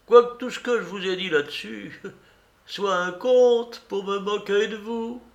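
high, mocking old beggar; deeply ironic. A narrator's reading. Beggar saying it was all a joke, ironic high voice. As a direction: taunt them in a high old voice. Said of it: "d'un air profondément ironique".

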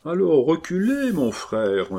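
reply as a sanctimonious brother, voice lifted; devout reply. A narrator's reading. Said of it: "répondit dévotement Loncavoine".

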